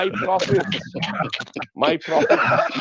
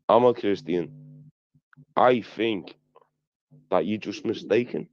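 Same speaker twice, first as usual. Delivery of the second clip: calm him down with a heartfelt point; sincere statement; 9 semitones lower than usual. Real, not acted. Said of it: "can I make a statement without interruption".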